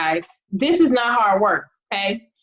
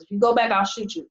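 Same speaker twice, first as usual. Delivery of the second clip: quick hard threat; 2 semitones lower, 3 dB quieter, faster than usual. Retold or imitated. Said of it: "That's how serious she was".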